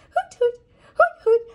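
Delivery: high, loud hoots; hooting owl. Acted. Mother hooting the owl's call for her toddler. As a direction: hoot like an owl.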